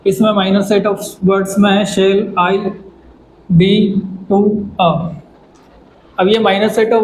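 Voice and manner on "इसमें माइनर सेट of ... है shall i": normal talking voice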